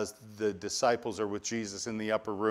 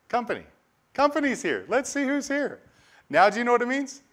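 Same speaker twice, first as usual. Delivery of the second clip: excited recollection; 12 semitones higher, 4 dB louder than usual. Retold or imitated. Excited retelling of the doorbell.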